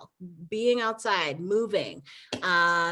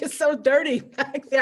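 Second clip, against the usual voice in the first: laughing through husband's words; laughing quote; 7 semitones higher, 6 dB louder than usual. Retold or imitated. Wife retelling husband's jab at New York, laughing. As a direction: giggle while quoting him. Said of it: "he's like".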